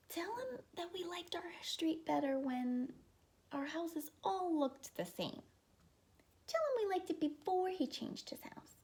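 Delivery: high, pleading neighbor voices; fussy neighbors. Acted. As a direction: beg the neighbor to talk sense into him.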